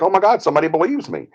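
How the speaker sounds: high-pitched retold relief; imitated sudden happiness. Client hearing somebody believes her, imitated sudden happiness. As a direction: play her delight, pitched well up. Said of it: "she's all of a sudden happy".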